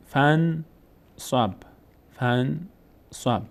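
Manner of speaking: each syllable drawn out, pause between; slow syllable-by-syllable reading. Shown as a demonstration. Teacher models exercise words for students, slow syllable-by-syllable reading. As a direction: sound it out slowly for learners to repeat.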